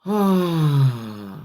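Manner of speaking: long audible out-breath sigh; slow releasing sigh on the out-breath; demonstrated sigh. Shown as a demonstration. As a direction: show them the calming exhale, sound and all. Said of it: "you have to make that sound".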